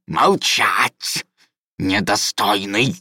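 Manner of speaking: slow, hissed fury; furious hiss. Acted. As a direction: hiss it out like a predatory lizard. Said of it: "яростно прошипел исправник".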